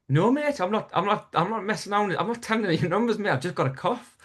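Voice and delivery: high, hurried self-defence; panicked protest. Retold or imitated. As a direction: plead innocence, mock-alarmed. Retold protest to threatening domino player.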